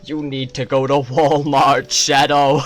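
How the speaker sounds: loud mock devil, laughing through it; a playful devil talking to Shadow. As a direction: call Shadow like a cartoon tempter. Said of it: "the devil".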